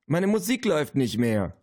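high, angry complaint. A narrator's reading. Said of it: "fragte sie wütend". (wütend, hoch klagend)

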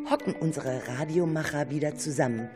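ordinary delivery

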